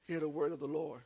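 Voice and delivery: ordinary talk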